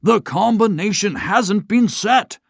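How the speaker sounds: put-on an old banker in dismay; groaning old banker. A narrator's reading.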